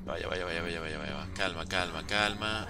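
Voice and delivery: low, soft repeated words under tension; self-calming murmur. Real, not acted. Player urging calm in a tense spot, self-calming murmur. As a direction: murmur to settle your own nerves.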